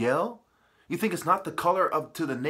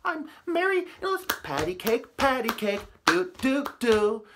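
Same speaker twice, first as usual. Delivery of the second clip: much higher, softer sing-song mockery; mocking girly voice; 5 semitones higher, 4 dB quieter than usual. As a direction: parody a sweet naive girl. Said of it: "It's not this".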